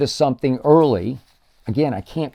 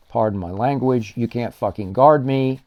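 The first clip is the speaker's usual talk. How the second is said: ordinary talk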